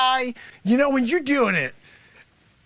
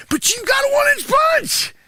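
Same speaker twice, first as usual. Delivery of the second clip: shouted high and excited; hyped-up pitch; 13 semitones higher, 3 dB louder than usual. Acted. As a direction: sell it like a huckster at full volume. Sensei hyping his pricey punch.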